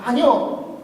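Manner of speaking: usual speaking voice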